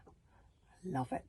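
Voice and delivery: plain reading voice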